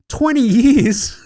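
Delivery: slow, loud and high disbelief; incredulous. Real, not acted. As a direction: scoff at the idea, stretching it out.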